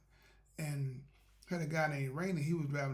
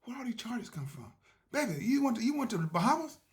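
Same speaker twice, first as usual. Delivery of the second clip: higher and louder, playful accusing disbelief; hammed-up shock at the bill; joking at his wife about the charges; mock outrage; 7 semitones higher, 7 dB louder than usual.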